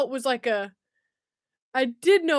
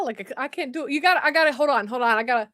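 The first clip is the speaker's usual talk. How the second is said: ordinary delivery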